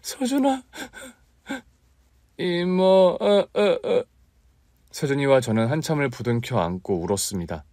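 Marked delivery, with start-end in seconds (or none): 0.0-4.0 high sobbing calls; crying together. Acted. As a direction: sob out each name. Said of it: "엉엉 소리내 울고 있더군요".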